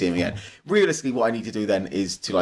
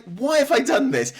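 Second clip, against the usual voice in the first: bemused self-question; 12 semitones higher than usual. Retold or imitated. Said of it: "And I was like".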